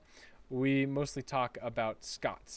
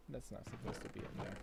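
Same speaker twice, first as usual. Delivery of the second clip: much softer, half under the breath, puzzled; muttered to self; 10 dB quieter than usual. Real, not acted. Gamer spotting a misplaced block in his build, soft puzzled mutter. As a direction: mumble it to yourself as you spot the error.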